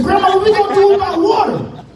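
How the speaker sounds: put-on high pleading child; a kid begging up high; whiny kid voice. Child asking grandma about water, whiny and high.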